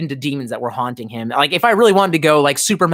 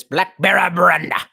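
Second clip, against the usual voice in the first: put-on old Southern twang. Shown as a demonstration. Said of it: "I pronounce it in this".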